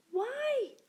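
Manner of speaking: put-on high small child voice; little tortoise child. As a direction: ask like a curious little kid.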